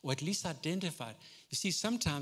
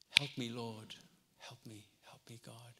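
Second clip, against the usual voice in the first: lower, much softer plea; hushed pleading prayer; 5 semitones lower, 7 dB quieter than usual. Acted. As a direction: pray it almost under breath.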